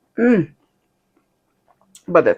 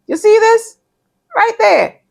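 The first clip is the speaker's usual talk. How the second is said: high-pitched; 4 dB louder than usual. Real, not acted. Pointing out the toothpick.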